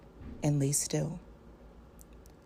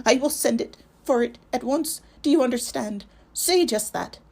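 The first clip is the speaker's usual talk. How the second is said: much higher and louder, flat and shrill; shrill, toneless cry; 7 semitones higher, 7 dB louder than usual. Acted. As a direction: cry it out like a possessed voice, no feeling in it. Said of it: "he cried in a shrill and toneless voice".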